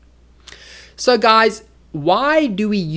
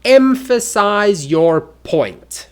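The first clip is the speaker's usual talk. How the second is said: loud, slow stress on each word of "emphasize your point"; emphatic; 4 semitones higher, 6 dB louder, slower than usual. Shown as a demonstration. Teacher showing how to make a point, slow heavy emphasis. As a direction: punch "emphasize your point" slowly and firmly. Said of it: "It can also emphasize".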